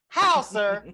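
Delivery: loud, high mock demand; mock exasperation. Demanding answers from an absent actor, exasperated. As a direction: challenge him in playful disbelief.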